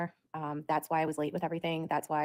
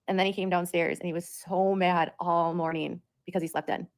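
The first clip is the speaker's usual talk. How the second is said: normal talking voice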